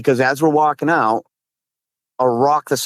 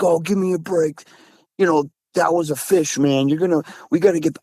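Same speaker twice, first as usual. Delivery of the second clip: higher-pitched imitation of a scoffing buddy; dismissive friend, retold; 6 semitones higher than usual. Imitating a friend arguing it was a fish, dismissive. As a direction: brush it off as the friend did.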